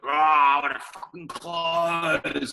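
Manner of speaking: very slow, drawn-out greedy salesman imitation; mock greedy closer. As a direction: drag it out like a pushy seller. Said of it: "these guys who are like".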